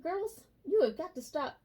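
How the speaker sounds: high, loud mock scold; scolding pets. Real, not acted. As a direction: tell off the cats. Scolding her cats.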